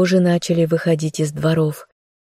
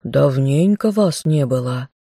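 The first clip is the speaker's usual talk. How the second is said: usual speaking voice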